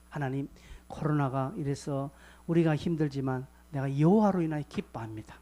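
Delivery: ordinary delivery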